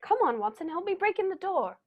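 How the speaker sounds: high, urgent command; rally him to break the door down; hurried and pressing; urgent call to action. Acted. Urgent rush after the gunshot.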